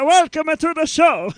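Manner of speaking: put-on loud, high Italian accent shout. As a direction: belt it like a hammy Italian host. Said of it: "I can do an Italian".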